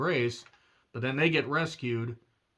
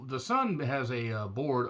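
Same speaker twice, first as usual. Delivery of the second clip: ordinary talk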